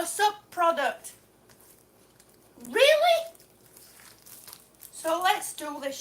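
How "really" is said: high, loud, disbelieving question; incredulous. Real, not acted. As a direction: throw it out in indignant disbelief. Indignant at damaged product.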